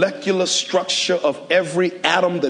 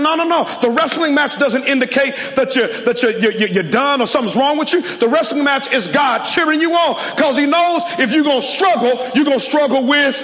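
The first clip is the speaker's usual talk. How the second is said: rising, pressing sermon climax; preaching at full force; 8 semitones higher, 3 dB louder than usual. Acted. Pastor urging the congregation, in full preaching cry. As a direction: preach it higher and harder to the crowd.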